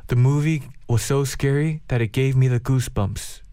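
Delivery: very slow, careful English; slow teaching voice. Shown as a demonstration. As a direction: say it slowly for learners. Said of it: "이렇게 말하면 될 것 같아요".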